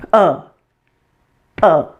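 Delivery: short relaxed "uh", air pushed out; punched-out schwa. Shown as a demonstration. Teacher demonstrating a punch in the stomach, short schwa grunt. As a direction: say "uh" as if hit in the stomach. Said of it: "punch yourself in the stomach like this".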